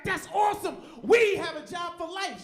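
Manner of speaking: husband cheering about his wife's tenure; mock excited shout. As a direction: cheer like you just won the lottery. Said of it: "I was like".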